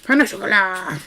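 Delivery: hammed-up French pan au chocolat; exaggerated French with long final vowel; silly French accent. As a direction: interject in stagey French. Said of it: "in a stupid French accent".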